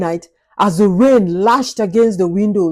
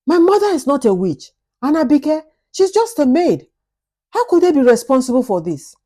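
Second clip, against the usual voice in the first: rising indignation; 6 semitones higher than usual. Acted. Husband defending his mother to his wife, pitch rising in indignation. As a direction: pitch climbing higher with each phrase, faster at the end.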